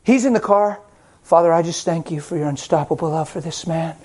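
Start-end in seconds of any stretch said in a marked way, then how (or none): 1.2-3.9 prayer. Real, not acted. Healing prayer over a man.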